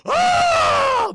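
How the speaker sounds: gasp, then high loud scream; British scream. Retold or imitated. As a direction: suck in air and let out a shrieking British wail. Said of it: "Very British scream".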